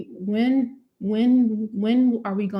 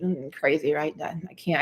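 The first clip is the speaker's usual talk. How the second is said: ordinary talk, nothing marked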